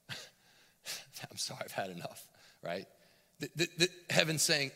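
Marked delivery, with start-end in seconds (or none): none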